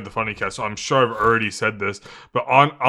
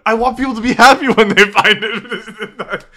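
higher, louder, through laughter; laughing protest; 8 semitones higher, 7 dB louder than usual. Real, not acted. Objecting to co-host's bullshit treasure, laughing. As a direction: protest loudly while cracking up.

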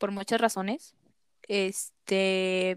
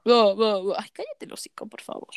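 exasperated. Real, not acted. Annoyed at the ad.

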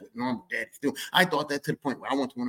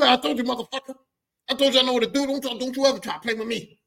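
higher, cocky told-you-so; gloating dad; 11 semitones higher, 4 dB louder than usual. Retold or imitated. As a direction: brag right in his face.

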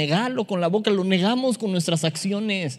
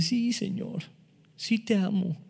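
soft reply; 4 semitones higher, 5 dB quieter than usual. Acted. Peter answering Jesus softly.

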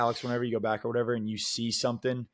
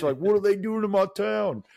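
higher, hammed-up indignant old man; old man gripe; 8 semitones higher, 3 dB louder than usual. Griping like an old man about town, high whine. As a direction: whine like a grumpy elder.

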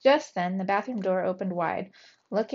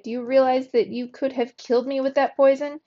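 angry scolding; 5 semitones higher, 6 dB louder than usual. A narrator's reading. Scolding cousin after poisoning.

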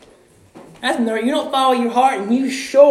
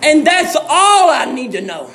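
full-force preaching; 6 semitones higher than usual. Acted. Preacher rousing the church about eternity, full-force preaching. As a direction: preach it out to the congregation with fire.

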